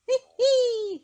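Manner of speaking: very high, loud gleeful hee hee; chortle. Acted. Mister Happy chortling to himself over his idea, gleeful and high. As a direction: chortle with glee at his plan. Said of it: "he chortled to himself".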